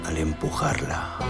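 ordinary delivery, nothing marked

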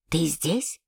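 a hushed father on the stairs; whispered. A narrator's reading. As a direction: ask it under your breath. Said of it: "сказал он шёпотом".

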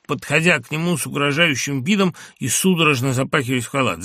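ordinary delivery

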